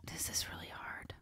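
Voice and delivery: barely-there breath of a whisper; whispered aside. Real, not acted. Singer mulls stadium show or intimate venue, whispered. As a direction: whisper it to yourself while deliberating. Mulling a hard choice aloud.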